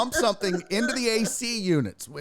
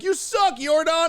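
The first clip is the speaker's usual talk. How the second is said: higher mock heckle; heckling fan; 8 semitones higher than usual. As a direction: jeer at the player from the stands. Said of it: "you'd be like".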